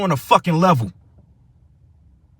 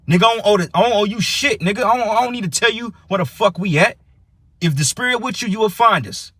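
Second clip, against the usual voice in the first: much higher, heated; angry refusal; 7 semitones higher than usual. Real, not acted. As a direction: flatly refuse them, rising. Refusing to share location, angry.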